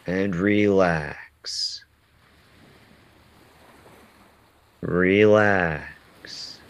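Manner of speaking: slow, calm meditation guide; slow calming affirmations with long pauses. Acted. Leads a guided breathing meditation.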